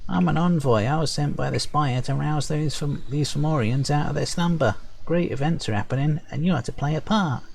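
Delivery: high, perky little sprite voice. Acted. Sprite envoy explaining his mission, perky and high. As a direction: chirp it brightly, high up. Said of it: "perky, high-pitched voice".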